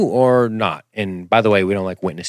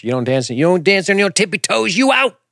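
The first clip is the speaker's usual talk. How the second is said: mock snooty theater company; 9 semitones higher than usual. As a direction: shoo her out in a shrill mock voice. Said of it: "the theater company's gonna be like".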